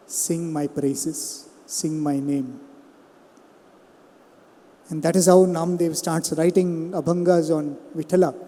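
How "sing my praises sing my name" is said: softer, slow and hushed, like a god granting a blessing; gentle divine command. Acted. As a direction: lay the words down softly and slowly. God Vithala instructs Namdev.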